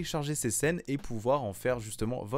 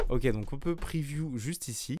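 ordinary talk

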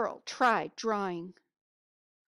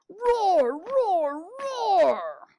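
loud, high playful roars; bear roaring; 11 semitones higher, 11 dB louder, much slower than usual. Acted. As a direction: roar like a storybook bear.

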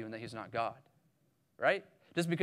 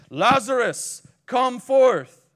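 louder, higher call across a grave; loud cry; 7 semitones higher, 10 dB louder, much slower than usual. A narrator's reading. Jesus crying out to dead Lazarus, loud command. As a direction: summon the dead man out with full voice. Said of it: "he cried with a loud voice".

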